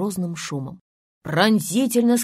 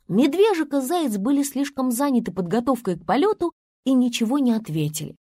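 ordinary delivery, nothing marked

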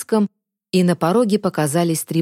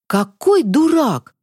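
higher, drawn-out exclamation; horrified self-reproach; 7 semitones higher, 3 dB louder, slower than usual. A narrator's reading. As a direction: slowly exclaim in horrified realization. Boy realizes his terrible mistake. (с ужасом ругая себя)

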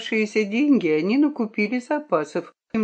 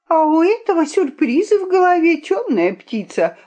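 high-pitched scolding; lashing out; 10 semitones higher, 4 dB louder than usual. A narrator's reading. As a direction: pounce on them in a high, indignant voice. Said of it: "обрушилась на петуха Виолинка". (высокий возмущённый наскок)

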